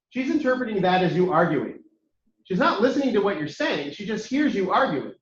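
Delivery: ordinary delivery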